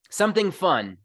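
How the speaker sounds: ordinary delivery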